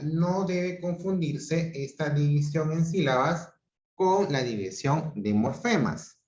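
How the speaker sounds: ordinary delivery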